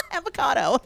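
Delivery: high giggly mispronounced avocado; girl giggling avocado at him. Retold or imitated. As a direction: giggle then chirp avocado like a ditzy fan.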